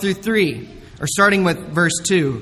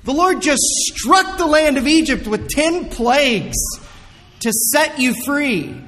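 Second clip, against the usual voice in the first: much higher, exasperated disbelief; incredulous outrage; 7 semitones higher than usual. Pretend. Preacher mocking ungrateful Israel, incredulous and higher. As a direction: ask it like you cannot believe what you just saw.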